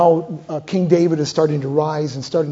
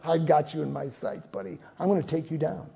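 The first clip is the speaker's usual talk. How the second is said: quiet menace; 2 semitones lower, 11 dB quieter than usual. Acted. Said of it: "And Asahel goes".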